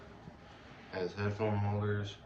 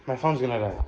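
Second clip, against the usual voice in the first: ordinary talk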